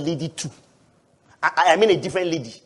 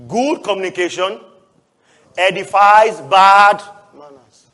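slow, louder stress on edifies; emphatic flip; 7 dB louder, slower than usual. Shown as a demonstration. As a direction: lean hard on "edifies bad manners". Flipped verse, slow emphasis.

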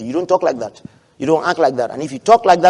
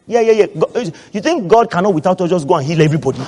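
ordinary delivery

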